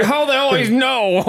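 high, hammed-up mimic voice; baffled mimic. Game master voices a mimic who keeps getting found, high mock wail. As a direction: play a baffled creature wailing in high pitch. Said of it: "He's like".

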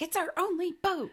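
high, mock-indignant protest; hammed-up indignant complaint; pissed-off wife. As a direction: imitate an outraged wife, pitched high.

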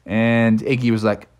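ordinary delivery, nothing marked

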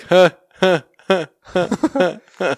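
high, loud, breathy huh-huh laugh; sprinkler laugh. Retold or imitated. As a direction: imitate a slow motion sprinkler laugh. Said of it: "sounded like a slow motion sprinkler".

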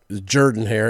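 Jordan said as "Jurdan", first vowel like "her"; preferred local pronunciation. Shown as a demonstration. Host giving the stadium's preferred name, Jordan as "Jurdan". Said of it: "as I think they prefer to call it".

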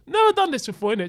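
normal talking voice

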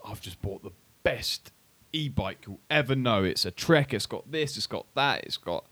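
hammed-up gushing about a new purchase; imitating a boaster. Acting out someone boasting of purchase.

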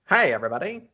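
put-on light old-timey greeting; a hint of a Mid-Atlantic announcer; Mid-Atlantic accent. Shown as a demonstration. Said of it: "that Mid-Atlantic accent".

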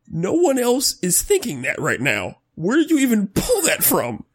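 high, strained disbelief, laughing; fed up, voice climbing; exasperated president. Retold or imitated. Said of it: "here's what I wrote down".

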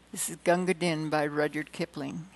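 ordinary talk, nothing marked

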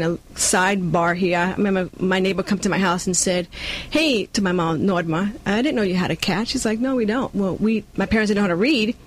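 ordinary talk, nothing marked

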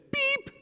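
tiny, very high beep; short squeaky chick noise; chick peep. A narrator's reading. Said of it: "her chick said".

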